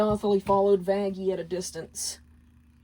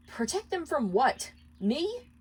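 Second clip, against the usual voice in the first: higher voice, disbelieving question; suspicious questioning; 7 semitones higher than usual. Acted. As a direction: question her, hurt and wary. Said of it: "interrogate the meaning".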